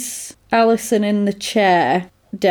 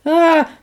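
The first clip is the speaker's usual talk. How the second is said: startled cry; 8 semitones higher, 3 dB louder than usual. Retold or imitated. Startled by gunshot.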